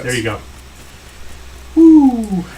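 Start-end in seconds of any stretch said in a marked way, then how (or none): 1.7-2.4 loud, high whoop; excited whoop. Acted. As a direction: whoop it up loudly. Mock cheer after political joke.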